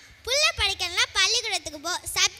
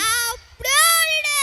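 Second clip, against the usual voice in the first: higher, drawn-out brush-off; brusque refusal; 4 semitones higher, slower than usual. Acted. Local refuses the traveller begging for a phone, brusque refusal. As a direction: wave the beggar away with your voice.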